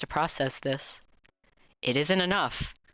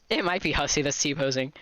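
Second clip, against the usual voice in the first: usual speaking voice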